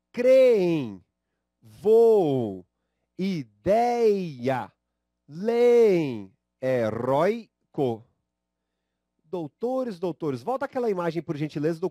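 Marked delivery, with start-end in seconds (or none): 0.1-8.0 each word said loud with the penultimate syllable hit hard; stressed syllables run. Shown as a demonstration. Teacher drills the class on the stressed syllables, loud. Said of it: "a sílaba tônica ... é a penúltima".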